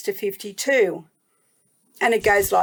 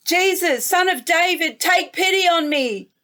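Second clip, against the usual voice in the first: a mild yell, pitch raised higher, pleading harder; calling out more; 6 semitones higher than usual. A narrator's reading. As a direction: call out again, more insistently. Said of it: "made him want to yell out even more".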